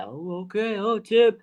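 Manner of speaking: retold "ooh" of rising anticipation; hyped-up reaction. Fan retelling his reaction to a reporter's hype, mock excited.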